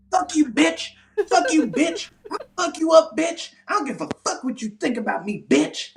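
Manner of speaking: high-pitched retold tirade; hammed-up crackhead rage, pitch way up; mock furious cursing. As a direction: hurl insults in a shrieky high voice. Acted crackhead screaming insults back.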